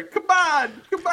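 high, loud "come on" complaint; exasperated protest. Real, not acted. Co-host objecting to a spoiler being read out, loud exasperated protest. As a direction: protest loudly, pitch jumping up.

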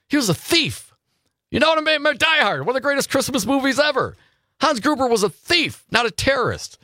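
high-pitched, animated argument; emphatic insistence. Real, not acted. Host insisting to listeners about a Die Hard villain, emphatic. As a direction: pitch it way up, driving the point home.

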